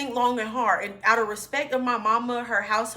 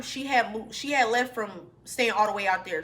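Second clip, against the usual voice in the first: ordinary talk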